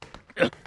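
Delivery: sharp dry cough. Real, not acted. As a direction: cough hard. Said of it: "I'm choking on my coffee".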